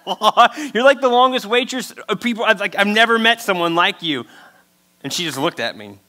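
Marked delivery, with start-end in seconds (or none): 0.0-4.3 amazed, higher and laughing; laughing disbelief. Retold or imitated. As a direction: laugh out the disbelief at the veteran waitress. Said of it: "I was like".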